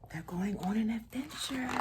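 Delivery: soft whispered aside; excited whisper. Real, not acted. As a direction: whisper it like a shared secret. Aside to listening children.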